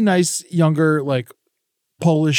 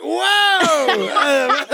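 high drawn-out whoa; mock stunned, pitched high; mock surprise; 12 semitones higher than usual. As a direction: act stunned by the snitch. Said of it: "I was like".

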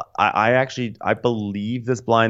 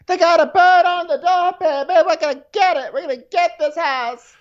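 very high, giddy voice gushing about the bird; high squeaky Jennifer impression; 3 dB louder than usual. Wife gushing to husband over the house, very high and giddy. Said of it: "She's like".

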